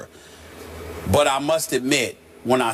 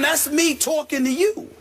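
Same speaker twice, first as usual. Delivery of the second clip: excited insistence. Real, not acted. Excited explaining.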